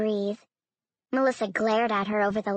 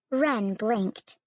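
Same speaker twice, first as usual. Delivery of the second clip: ordinary delivery, nothing marked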